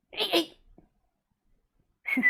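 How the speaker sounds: very high, soft sing-song coo to the dog. Real, not acted. Owner cooing softly at her hungry dog, high baby talk.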